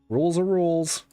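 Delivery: stern guard. Acted. Guard repeating refusal.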